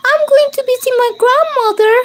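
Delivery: put-on high, quick little girl voice. A narrator's reading. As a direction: chirp it like an innocent child. Little girl answers the wolf.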